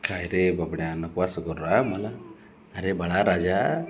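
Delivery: low, gentle coaxing; tender pleading. Real, not acted. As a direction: coax a sulking little one in a low voice. Pleading tenderly with pet to behave.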